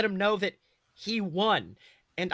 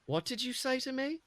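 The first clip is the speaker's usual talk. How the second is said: higher, sneering challenge; annoying tone; 7 semitones higher, 4 dB quieter than usual. Acted. Draco confronting the boy who mocked him, sneering higher. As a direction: needle him like a spoiled brat. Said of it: "in that annoying tone of his".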